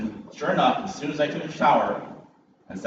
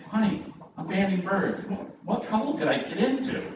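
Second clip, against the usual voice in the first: pitched up, all innocence; mock innocent; 8 semitones higher than usual. Husband reassuring his wife in a higher, mock innocent voice. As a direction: play the harmless husband, voice lifted high. Teasing reply to worried wife.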